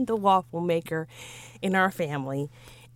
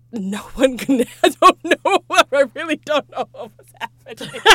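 louder, laughing at herself; 3 semitones higher, 7 dB louder than usual. Real, not acted. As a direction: giggle helplessly through it. Laughing at her own story.

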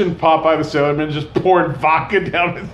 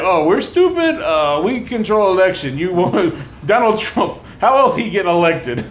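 mock Russian; 2 semitones higher than usual. Mocking Russians about election, mock Russian. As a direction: lightly play a smug Russian. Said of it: "It's like".

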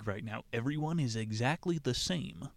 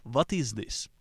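light mock Russian accent on what is this, w said like v; a hint of a Russian accent; mock Russian accent, asking "vhat is this". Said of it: "Are you Russian? Nobody talks like that here".

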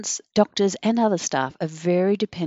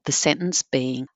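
ordinary delivery

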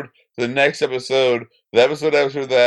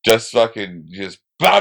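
normal talking voice

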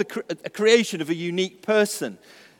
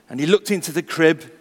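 ordinary delivery

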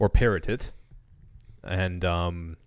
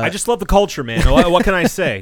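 loud, higher, smug defensive dude; 8 semitones higher, 8 dB louder, faster than usual. Pretend. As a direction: play the oblivious guy defending himself.

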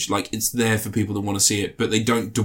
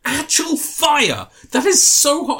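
much higher, slow, theatrical fuss over tea; mock scalded complaint; 4 dB louder, slower than usual. Friend quoting the scene's second sip, high theatrical fuss. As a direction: wail it like the tea is lava. Imitating Hugh Grant again, hotter.

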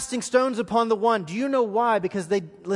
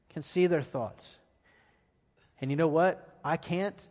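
usual speaking voice

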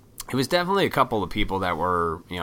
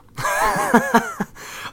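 high burst of laughter; real laugh; 4 dB louder than usual. Real, not acted. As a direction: crack up at your own dark joke. Laughs at own morbid joke.

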